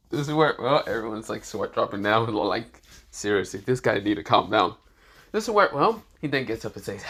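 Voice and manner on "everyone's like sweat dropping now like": ordinary delivery, nothing marked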